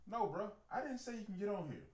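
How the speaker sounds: high, loud mock telling-off; indignant scolding. Boat captain to a stranger who just jumped on the boat, indignant scolding.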